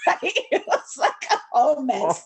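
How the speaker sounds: real laugh running through the words; slow, laughing recollection. Real, not acted. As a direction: chuckle through it at the absurdity. Laughs about the mess in hindsight.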